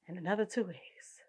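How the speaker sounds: soft whisper; whispered sign-off. Real, not acted. Signing off to listeners in a whisper. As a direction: breathe the goodbye.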